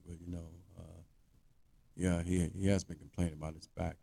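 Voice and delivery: low, very soft, halting excuse; hesitant manager. Acted. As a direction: mumble a reluctant defense as the manager. Manager's timid defense, imitated.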